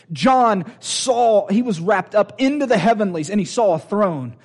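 driving, emphatic pulpit delivery; impassioned preaching. Real, not acted. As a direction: preach it out to the whole room. Preaching at full force.

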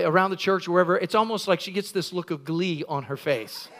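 ordinary talk, nothing marked

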